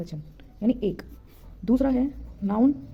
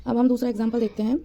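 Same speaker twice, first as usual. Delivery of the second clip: ordinary delivery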